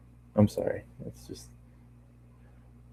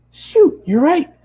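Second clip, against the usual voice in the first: much higher, louder pony cry of dismay; high dismayed Pinkie; 5 dB louder, slower than usual. Acted. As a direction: squeak out the oops. Pinkie voiced, slaps forehead.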